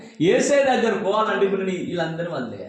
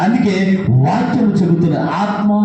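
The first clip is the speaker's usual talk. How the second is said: fiery preaching; 8 dB louder than usual. Acted. Preacher rousing the congregation about being used, fiery preaching. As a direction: preach it out to the back rows.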